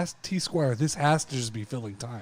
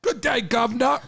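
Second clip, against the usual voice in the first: high, loud hammed-up British greeting; playful fake British accent; mock British accent; 11 semitones higher, 8 dB louder than usual. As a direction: put on a cheesy British gent. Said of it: "I've always wanted to have an accent".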